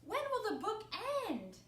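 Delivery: loud, high, stretched storybook voices; urgent exchange. A narrator's reading. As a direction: call it out with mounting urgency. Characters fret over book ending.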